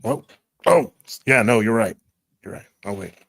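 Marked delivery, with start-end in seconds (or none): none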